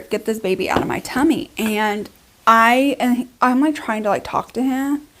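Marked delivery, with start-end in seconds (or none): none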